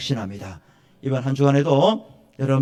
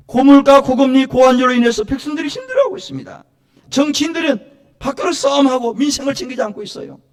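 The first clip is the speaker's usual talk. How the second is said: high, loud plea to God; raised praying voice, insistent and pleading; fervent dawn prayer; 12 semitones higher, 8 dB louder than usual. Acted. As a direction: cry out the petitions as in urgent prayer. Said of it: "저는 새벽마다 이렇게 기도해요".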